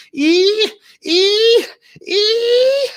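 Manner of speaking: very high, loud squealing creak; squeaky hinge whine. A narrator's reading. Imitating the skeleton's jaw hinge, high squeaks. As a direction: mimic the jaw spring whining.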